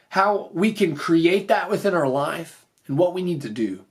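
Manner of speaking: usual speaking voice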